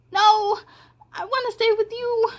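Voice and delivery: put-on little girl clinging to mom; pleading child. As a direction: beg not to be left behind. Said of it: "hard time being away from her mom".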